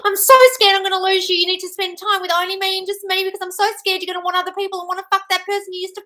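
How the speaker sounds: high, loud whiny mock panic; mocking clingy partner. Host mocking an insecure partner to viewers, whiny and high. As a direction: parody a jealous partner begging.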